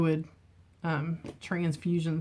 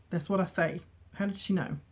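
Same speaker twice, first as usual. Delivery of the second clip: usual speaking voice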